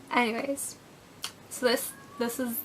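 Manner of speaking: ordinary delivery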